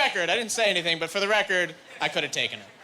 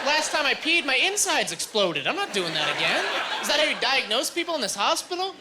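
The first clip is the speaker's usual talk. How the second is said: raised, sarcastic rant; high mocking rant; 6 semitones higher than usual. Patient mocks the doctor, high sarcastic rant. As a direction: scoff at the doctor's logic.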